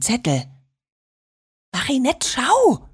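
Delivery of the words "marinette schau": high, excited hush to a sister; hushed excitement. A narrator's reading. Liliana shows her sister the find, in an excited hush. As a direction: keep it secret but urgent, pitched up. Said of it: "flüsterte Liliana".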